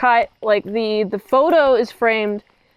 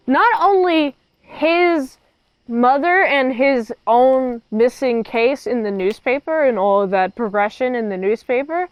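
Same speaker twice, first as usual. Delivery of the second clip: usual speaking voice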